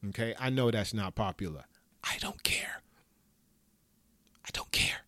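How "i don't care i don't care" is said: whispered. Real, not acted. Defiant aside on being atheist.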